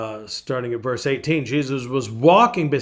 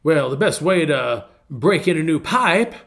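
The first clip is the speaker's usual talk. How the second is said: put-on Southern gentleman's accent; 3 semitones higher, 6 dB louder than usual. Pipe smoker answers his interviewer, imitated Southern gentleman's accent. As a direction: play the Southern gentleman, landing the answer high and loud. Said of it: "true Southern gentleman's accent".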